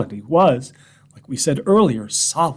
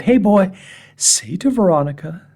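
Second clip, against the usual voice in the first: eager coaxing; 7 semitones higher, 5 dB louder than usual. Retold or imitated. Urges dog before his wife.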